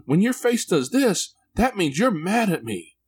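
put-on very high, slow child explaining; imitating his son. Son explains dad's frown means anger, high slow kid voice. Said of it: "And he said".

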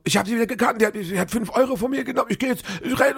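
shrill out the realisation in a hurry; agitated calling out. Retold or imitated. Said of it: "rief dann so".

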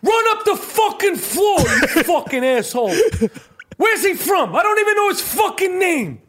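high, shouting courtside tirade; Danny Aiello as coach. Retold or imitated. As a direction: tear into the players as Aiello. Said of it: "Danny Aiello would be a good".

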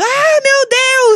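high, loud, drawn-out cry of panic; mock horrified scream from the friend caught peeing; panicked scream. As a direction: shriek as if caught exposed. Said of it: "ela gritando".

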